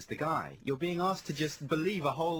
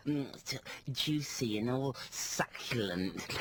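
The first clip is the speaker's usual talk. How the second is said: slow, relishing, a hint of the advert woman; drawn-out mock savouring of the food; imitating advert woman; slower than usual. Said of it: "She does that".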